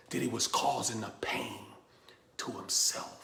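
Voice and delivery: very low, very soft reveal; hushed, solemn. Real, not acted. Speaker revealing the snake hurt himself, hushed. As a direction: sink almost to a whisper for the moral. Somber moral of the story.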